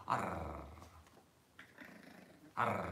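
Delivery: ordinary talk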